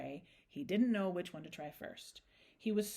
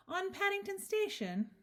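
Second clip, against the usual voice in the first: high-pitched disbelief; incredulous question; 12 semitones higher than usual. A narrator's reading. Mrs Brown doubting her husband's sighting, incredulous. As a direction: question it in a much higher voice.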